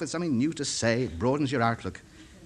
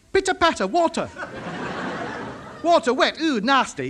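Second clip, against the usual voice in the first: explaining to foreigner; 5 semitones higher than usual. Acted.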